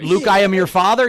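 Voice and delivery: loud Darth Vader line through a fan. Retold or imitated. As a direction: say it like into a whirring fan. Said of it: "talk into the fan and it would, like, modulate your voice".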